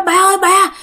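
high-pitched mock panic, easing toward the end; retold alarmed cry. Daughter tells father mother is possessed, crying out in alarm. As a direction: call out for help as a frightened child. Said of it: "chị của bạn mới la làng lên".

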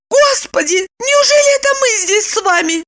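high, shocked woman's voice; alarmed cry. A narrator's reading. Woman suspects she slept with Roman, high alarmed cry. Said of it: "закричала".

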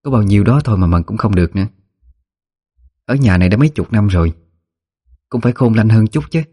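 mildly nagging complaint; grumbling. A narrator's reading. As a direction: grumble at a servant, lightly exasperated. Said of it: "rồi căng nhằn".

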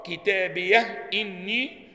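"كتابية إني" with a still h, then a clear glottal stop opening "إني"; hamza fully pronounced. Shown as a demonstration. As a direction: sound the hamza of "إني" after the pause-like h of "كتابية". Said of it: "وحققوا".

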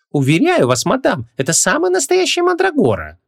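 high-pitched indignant shopkeeper. A narrator's reading. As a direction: protest your honesty, pitch soaring. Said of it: "возмутился продавец".

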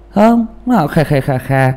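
slow hammed-up old man laugh kha kha kha kha; mock old man cackle. Tả tiếng cười ông già. (giọng cười khà khà của ông già)

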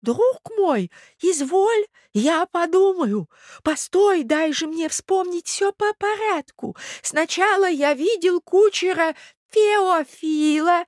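high, slow doddering prince; old prince, muddled. Acted. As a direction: recollect laboriously in a high old voice. (старый князь, путано вспоминает, высоко и медленно)

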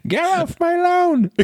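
put-on slow, high cranky old man; grumpy old man. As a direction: shoo kids off like a grumpy grandpa. Mocking cranky old grandad.

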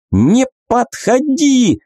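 high panicked yell, slow; hysterical yell. Acted. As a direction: scream in hysteria. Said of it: "в истерике заорал он".

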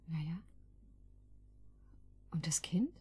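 soft whispered question; tentative whispered probe. Acted. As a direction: tread carefully, voice dropped to a breath. Delicate personal question.

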